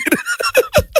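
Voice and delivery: squealing high laugh; real laugh. Real, not acted. After admitting he watched, high squealing laugh.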